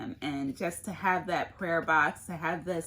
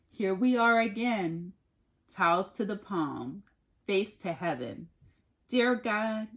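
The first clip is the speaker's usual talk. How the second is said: poem reading. Acted. Reads a poem aloud.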